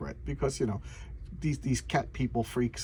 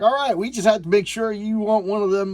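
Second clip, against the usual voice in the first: lightly voiced gang member, higher and louder; tough bar gang; 7 semitones higher, 6 dB louder than usual. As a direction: imitate a thug grudgingly letting someone in. Acts out bar gang accepting him.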